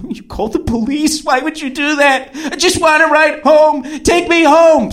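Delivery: high mock wail building to a yell; flipping out. Date learning police were called, high flipped-out mock wail. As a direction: imitate an aggrieved, frantic protest. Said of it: "She flips it".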